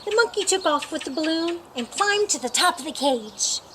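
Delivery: plain reading voice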